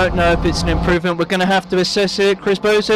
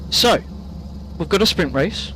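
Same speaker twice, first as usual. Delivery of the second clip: ordinary talk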